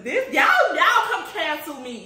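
loud, high, laughing dare; giggling challenge. Real, not acted. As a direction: laugh it out at the viewers. Laughing at viewers' question.

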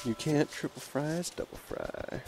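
trailing off into a soft whisper; slow, hushed echo of the slogan; fading whisper. Acted. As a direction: let the slogan die away to a breath.

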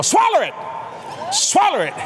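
high, shouted mock tempter's command; devil's urging. Devil tempting the new convert, high urgent command. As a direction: urge it in a high, forceful cry. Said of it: "I could hear the devil".

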